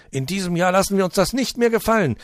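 high, loud protest; defiant shout. Acted. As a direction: shout your refusal. Residents protesting loudly. (trotziger Protestruf)

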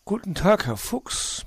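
high-pitched owl voice; high, lively Uhu; owl character greeting. Acted. Owl greets fox in story.

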